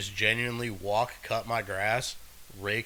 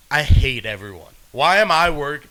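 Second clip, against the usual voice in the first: loud, acted despair of a fed-up clerk; hammed-up workplace exasperation; exasperated outburst; 11 dB louder than usual. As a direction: blurt it out in mock misery. Acting out fed-up store clerk.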